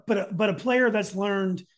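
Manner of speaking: ordinary delivery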